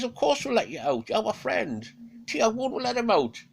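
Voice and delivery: a liar promising friendship; smooth-talking Odin. Acted. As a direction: Odin reassures Fenrir, high and loud. Odin reassuring falsely.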